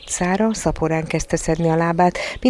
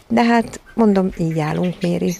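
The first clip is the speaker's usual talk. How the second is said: ordinary delivery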